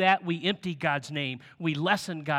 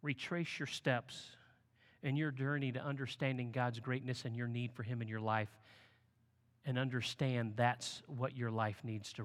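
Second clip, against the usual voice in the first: hushed; 4 semitones lower, 8 dB quieter than usual. Shown as a demonstration. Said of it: "Remember His faithfulness to you".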